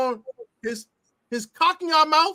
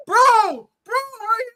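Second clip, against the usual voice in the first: high mock "bro, bro" voice, teammate calling out; mock teammate pleading high; imitating a teammate; 9 semitones higher, 4 dB louder, much slower than usual. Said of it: "He like, he like".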